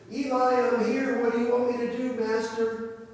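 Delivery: high, loud eager answer; eager young servant. Acted. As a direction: burst in and report for duty. Samuel runs to Eli again.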